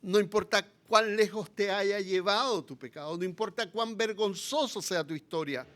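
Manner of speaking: ordinary talk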